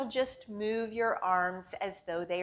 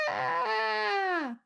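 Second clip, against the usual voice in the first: high startled cry; 11 semitones higher than usual. Acted. Canoe thrown in rapids.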